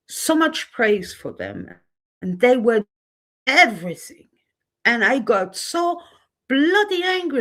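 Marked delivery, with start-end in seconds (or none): 5.6-7.4 angry outburst, pitch jumping. Real, not acted. Vlogger ranting about a fellow YouTuber, angry. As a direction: spit out the anger. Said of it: "I get angry already again".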